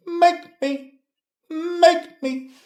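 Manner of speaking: very high, mocking "Make me"; teasing falsetto "Make me"; squeaky taunt. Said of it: "taunting the commenter".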